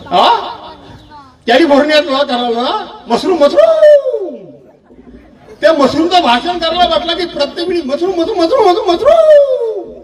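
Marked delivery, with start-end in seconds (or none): 3.1-4.4 drawn-out high mock voice, stretched slow; mocking rival. Mocking the rival's mushroom speech to the crowd, high drawn-out mockery. 7.8-9.9 rising higher and higher, last word hugely stretched and high; a parrot chanting its one word; mocking rival. Mocking how the rival repeats mushroom, climbing mock chant.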